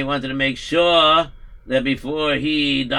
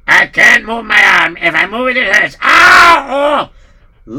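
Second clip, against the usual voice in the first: pained complaining; 5 semitones higher, 11 dB louder than usual. Acted. Patient in pain.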